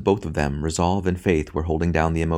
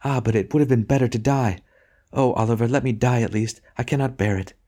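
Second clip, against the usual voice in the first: higher woman's voice, pleading; distraught wife; 6 semitones higher than usual. A narrator's reading. Wife pleading with her husband to let her die. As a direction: beg to die, lightly voiced wife in despair.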